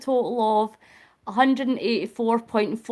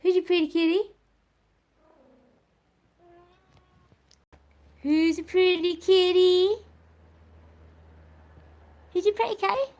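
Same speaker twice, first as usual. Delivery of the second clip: cooing pet talk; 7 semitones higher than usual. Real, not acted. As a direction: coo sweet nonsense at your cat, very high. Cooing to the cat.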